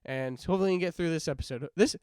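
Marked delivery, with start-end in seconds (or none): none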